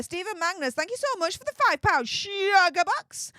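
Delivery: high-pitched, gushing shout-out; excited thanks. Real, not acted. Streamer thanks viewer for five pound sugar box, excited. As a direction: make the donation a high, showy flourish.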